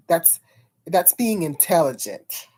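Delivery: normal talking voice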